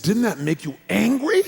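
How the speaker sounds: pointed retold prodding; incredulous question. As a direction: press him in mock disbelief. Incredulous question to calm buddy.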